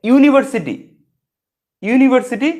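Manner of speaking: ইউনিভার্সিটি said high and loud, starting with a clear yu glide; yu sound stressed. Shown as a demonstration. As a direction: lean on the yu in ইউনিভার্সিটি. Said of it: "এখানে দেখো". (ইউনিভার্সিটি উচ্চ ও জোরে, স্পষ্ট 'ইয়ু' ধ্বনি দিয়ে)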